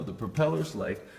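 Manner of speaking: usual speaking voice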